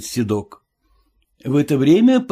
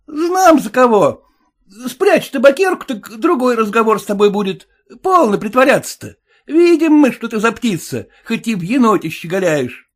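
much higher, louder wary coachman; high suspicious cabbie; cab driver voice; 10 semitones higher, 5 dB louder than usual. Acted. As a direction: play the sly cabbie, pitched well up.

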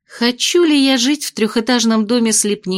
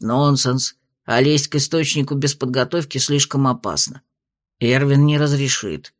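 lower, measured spirit voice; low dragon spirit voice; 7 semitones lower than usual. A narrator's reading. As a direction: speak as a wise old dragon spirit. Dragon spirit Amber speaks.

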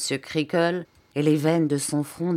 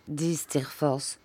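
ordinary delivery

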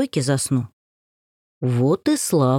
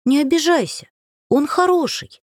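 put-on sweet young girl; 10 semitones higher than usual. A narrator's reading. Varenka pleads sweetly.

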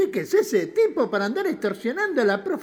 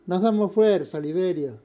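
usual speaking voice

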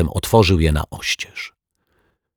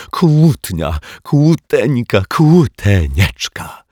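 loud, drawn-out delight; lovingly stretched, gloating exclamations; gleeful relish; 6 semitones higher, 5 dB louder, much slower than usual. A narrator's reading. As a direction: savour each pet name slowly. Detective savours the quarrel gleefully.

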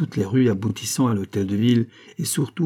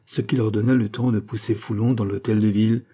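ordinary delivery, nothing marked

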